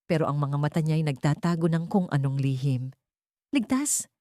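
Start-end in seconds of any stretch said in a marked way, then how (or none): 3.5-4.1 Ligtas pitched high in disbelief; startled echo; incredulous question. A narrator's reading. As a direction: throw the word back in doubt. Narrator questions the nurse in disbelief.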